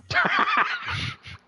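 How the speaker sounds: breathless, very high laughing wheeze; high wheezing laugh. Real, not acted. Laughing at donkey.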